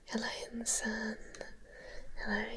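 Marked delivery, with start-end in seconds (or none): none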